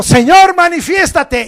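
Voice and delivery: grita alto y fuerte al cielo; clamor fuerte. Retold or imitated. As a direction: clama a Dios con toda la voz. El predicador clama a Dios. (clamor a gritos)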